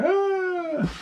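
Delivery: high mock cat cry. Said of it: "he comes to my bedroom crying".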